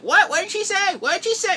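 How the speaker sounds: high, loud puzzled crowd voice; confused crowd. Retold or imitated. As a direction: play bystanders who missed it. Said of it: "people are like".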